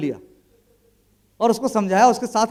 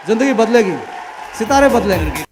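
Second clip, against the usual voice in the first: punchy, louder slogan with a pause between; loud proclamation; 6 dB louder than usual. Acted. Speaker rousing the audience with the slogan, louder. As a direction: proclaim it like a rallying cry.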